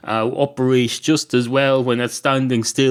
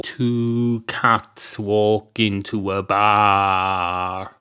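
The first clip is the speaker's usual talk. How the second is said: joke telling; 2 semitones lower, much slower than usual. Acted. Opens the joke slowly.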